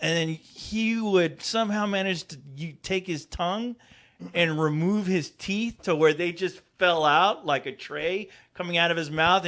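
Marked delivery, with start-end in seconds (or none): none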